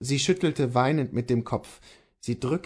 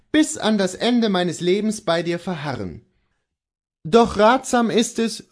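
confident, higher-pitched husband; Kohlhaas the husband; 8 semitones higher, 5 dB louder than usual. Acted. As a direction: reassure your wife with conviction.

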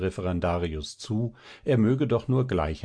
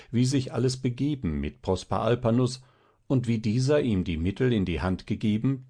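ordinary delivery, nothing marked

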